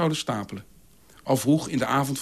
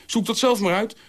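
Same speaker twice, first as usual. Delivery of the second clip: raised pitch, firmly closing the matter; resolute dismissal; 7 semitones higher than usual. A narrator's reading. Liedeke shutting down the discussion, resolute. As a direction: end the discussion right there. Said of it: "sloot Liedeke resolute discussie".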